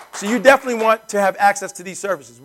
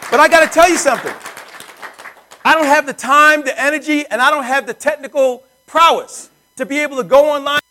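higher and louder, pushing the point hard; fired-up pitch; 6 semitones higher, 5 dB louder than usual. Real, not acted. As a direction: sell it with rising energy. Pitch-raising salesman emphasis.

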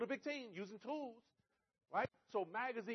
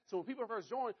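usual speaking voice

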